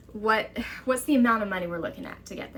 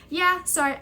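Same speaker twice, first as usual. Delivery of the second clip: higher, offhand lightly voiced caseworker; casual caseworker; 9 semitones higher, 4 dB louder than usual. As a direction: toss off a careless apology.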